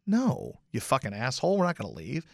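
a hint of an annoyed wife; imitating wife. Jilly refusing to leave the game, soft mock scold. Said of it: "And she said".

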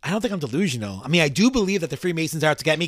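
ordinary delivery

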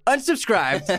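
high laughing unsubscribed; mock unsubscribe. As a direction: squeal it out through a laugh. Punchline of reading comments.

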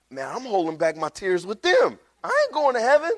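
high-pitched comic protest; mock alarmed thought. Convicted man thinking to himself at the door, mock alarm. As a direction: climb into a high, incredulous squeal by the end.

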